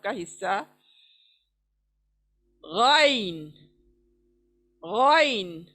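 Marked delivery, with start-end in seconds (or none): 2.6-5.6 गाइन voiced as a gargle at the top of the throat; gargled ghain. Shown as a demonstration. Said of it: "हलक के ऊपर का हिस्सा". (गरारे जैसा गाइन)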